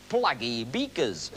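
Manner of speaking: plaggy beakers slow in a northern accent. Shown as a demonstration. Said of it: "as they say in the north".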